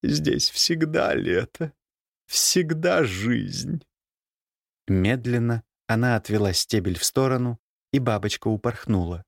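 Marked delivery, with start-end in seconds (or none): 0.0-3.8 slow ominous raspy drawl. A narrator's reading. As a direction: drawl it out slowly, hoarse. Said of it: "хриплым голосом сказала".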